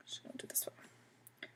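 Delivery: soft unvoiced whisper to herself; whispered aside. Real, not acted. Teacher whispering to herself mid-lesson, hushed aside. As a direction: breathe it under the voice while writing.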